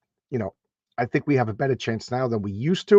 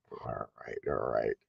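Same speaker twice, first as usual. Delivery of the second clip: much lower, soft and rumbling; deep voice; 11 semitones lower, 8 dB quieter, slower than usual. Shown as a demonstration. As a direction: drop way down into a deep voice. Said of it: "I'll use my, uh, deep voice now".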